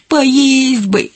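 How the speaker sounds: high, drawn-out little whimper; plaintive, high-pitched wail for food; whining. A narrator's reading. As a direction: whine like a hungry child. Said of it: "захныкала Косичка".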